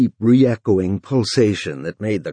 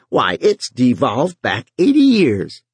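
brassy voice; 4 semitones higher, 4 dB louder than usual. Acted. Housewife ad continues.

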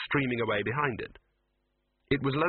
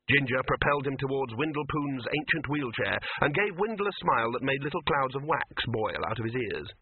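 plain reading voice

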